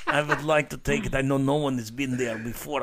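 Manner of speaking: hammed-up Russian guy accent, laughing through it. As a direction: lay on a joking Russian accent. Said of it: "I can do a pretty good Russian guy".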